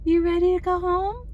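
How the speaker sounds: high sing-song to a pet. Real, not acted. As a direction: coo at the dog. Talking to her dog Ziggy.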